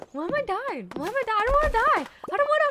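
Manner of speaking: very high, loud desperate wail; hammed-up panicked whine; panicked dying cry. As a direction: replay the whine of a dying player. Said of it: "it's like".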